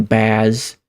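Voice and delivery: ordinary talk, nothing marked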